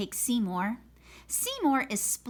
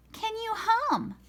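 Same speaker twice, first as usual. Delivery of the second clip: high teacher voice; 12 semitones higher than usual. Acted. Teacher voiced in read-aloud.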